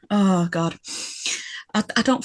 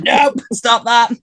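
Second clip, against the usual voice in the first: slow firm command; 4 semitones higher, much slower than usual. Retold or imitated. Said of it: "Yeah, just like".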